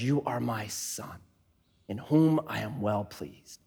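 put-on the Heavenly Father quietly blessing his child; tender fatherly voice. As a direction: speak it gently as a loving father. God speaking over his child.

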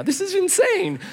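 very high-pitched incredulous squeal; mock disbelief. Companion watching a testimony in the office, squeaky disbelief. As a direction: let the voice leap up in amazement. Acts out his amazed disbelief.

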